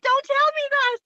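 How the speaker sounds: very high, loud retold wail of protest; mock protest. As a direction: protest in playful dismay, voice way up. Retelling own protest to teasing.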